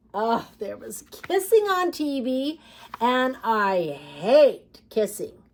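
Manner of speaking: put-on reading a picture book aloud to children; lively read-aloud with emphatic refrains; story narration. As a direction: tell it like a storybook for kids.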